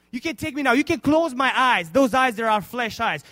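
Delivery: loud, high surrender; fervent preached quote. Acted. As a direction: give yourself up to God, loudly. Simeon ready to die.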